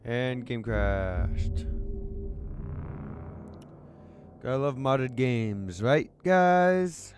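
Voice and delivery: loud, slow, fed-up groan of words; sarcastic frustrated outburst; loud exasperated. Real, not acted. Streamer's game crashes mid-scene.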